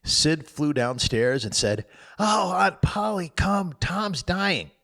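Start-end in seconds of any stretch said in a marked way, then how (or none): 2.2-4.7 put-on high, panicked boy calling out; alarmed call. Sid calling Aunt Polly downstairs, panicked. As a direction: rush to fetch help.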